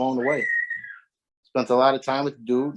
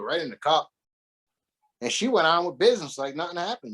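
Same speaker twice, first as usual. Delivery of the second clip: usual speaking voice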